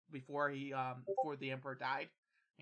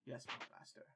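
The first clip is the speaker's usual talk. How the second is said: whispered reply; 3 semitones lower, 9 dB quieter, faster than usual. Acted. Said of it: "He's like".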